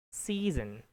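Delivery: "season" with long ee, buzzing z; correct season. Shown as a demonstration. Teacher repeating model for student, "season" correctly as SEE-zun. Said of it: "That would be the natural English".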